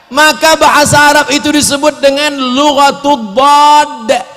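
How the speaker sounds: ordinary delivery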